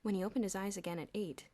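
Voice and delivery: ordinary delivery, nothing marked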